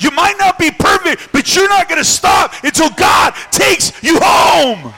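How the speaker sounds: building to a high, shouted climax; rally the congregation to get up; full-force preaching. Real, not acted. Preacher urges the fallen to keep striving, shouted preaching.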